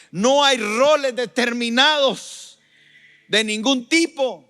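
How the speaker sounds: loud, high-pitched pulpit proclamation; full-force preaching. Acted. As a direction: thunder it out to the congregation.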